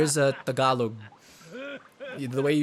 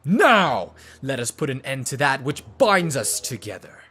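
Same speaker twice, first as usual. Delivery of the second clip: commanding villain; 7 dB louder than usual. Acted. Villain calling to end what binds them, commanding. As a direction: proclaim it like a grand villain.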